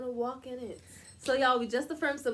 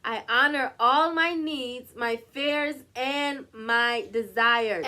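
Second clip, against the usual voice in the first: loud, emphatic affirmation, slowed down; like you mean it; 9 dB louder, slower than usual. Shown as a demonstration. Leading listeners, louder this last time, meaning it. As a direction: declare it with full conviction, loud. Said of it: "say it like you mean it".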